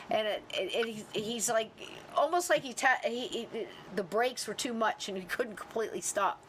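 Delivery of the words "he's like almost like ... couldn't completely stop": ordinary talk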